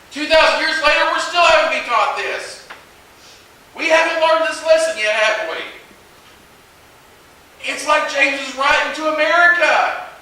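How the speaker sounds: loud, high preaching build; full-force preaching. Acted. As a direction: preach it hard to the congregation.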